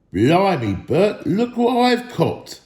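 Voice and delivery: loud, slow troll voice; troll William. A narrator's reading. William calls Bert over, loud slow troll voice. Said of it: "said William".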